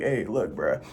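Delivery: laid-back buddy voice for Jesus; casual Jesus. Retold or imitated. Jesus greeting her like a friend, casual low voice. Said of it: "Jesus can meet me down here and be like".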